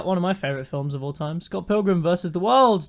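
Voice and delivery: ordinary delivery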